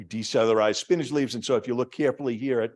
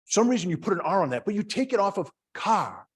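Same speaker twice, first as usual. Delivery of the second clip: ordinary talk, nothing marked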